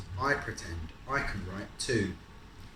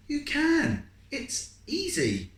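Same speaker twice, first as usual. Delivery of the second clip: higher, brighter big-sister voice, slow on easy; a confident older sister reassuring; 8 dB louder, much slower than usual. Acted. Sister's voice in story.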